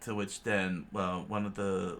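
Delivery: usual speaking voice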